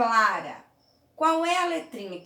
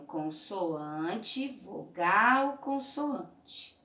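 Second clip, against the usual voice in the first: slow pattern readout; much slower than usual. Shown as a demonstration. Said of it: "Aqui eu tenho".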